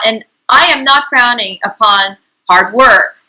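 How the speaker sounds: ordinary delivery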